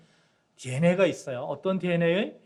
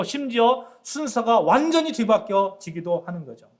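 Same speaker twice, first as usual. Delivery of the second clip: louder, higher preaching build; full-force preaching; 5 semitones higher, 6 dB louder than usual. Acted. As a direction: proclaim it to the congregation with fire. Preaching climax. (설교 절정에서 회중에게 힘차게 외치듯)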